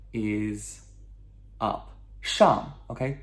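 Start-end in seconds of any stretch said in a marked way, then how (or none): 2.2-2.7 SHANG high and loud, falling sharply; descending fourth tone. Shown as a demonstration. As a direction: drop hard through "Shang" from a high start. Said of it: "fourth tone".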